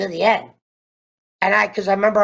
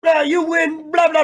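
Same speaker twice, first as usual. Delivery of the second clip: mocking Robbie; 7 semitones higher than usual. Imitating Robbie brushing off Kenny, high mock shout. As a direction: toss it off like a sneering fighter. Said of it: "Robbie all of a sudden goes".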